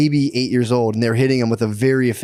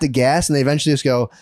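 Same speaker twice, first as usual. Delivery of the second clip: ordinary talk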